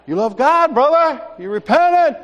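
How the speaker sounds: high, loud pressing challenge; insistent pastor. Retold or imitated. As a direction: push him with mock fervour. Pastor pressing him, acted out.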